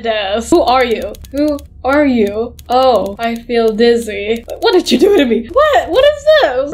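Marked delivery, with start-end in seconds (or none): 4.6-6.7 high-pitched startled protest; startled squeal of disbelief; alarmed. Real, not acted. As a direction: cry out in shock, pitch leaping. Alarmed at being attacked in game.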